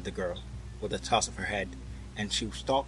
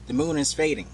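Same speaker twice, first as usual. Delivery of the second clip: higher, sudden remark; 5 semitones higher, 4 dB louder than usual. A narrator's reading. Boy notices the fading moon, suddenly. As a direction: come out with it suddenly. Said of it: "said the boy suddenly".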